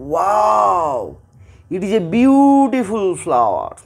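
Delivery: slow, wondering wow; amazed. Shown as a demonstration. Teacher showing wonder at a flower, slow amazed wow. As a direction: marvel at a lovely flower. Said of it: "আমার মনের মধ্যে যে বিস্মিত ভাব".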